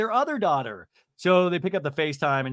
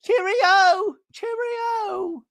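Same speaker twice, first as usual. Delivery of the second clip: very high, drawn-out mock British trill; hooting, hammed-up London farewell; Shannon; 8 dB louder, much slower than usual. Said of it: "And she goes".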